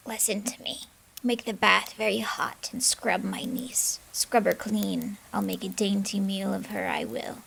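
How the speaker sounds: hissed witch whisper. Acted. Witch secretly orders the servant, whispering. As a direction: plot in a wicked whisper. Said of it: "Baba Yaga whispered to her servant".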